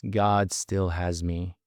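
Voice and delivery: usual speaking voice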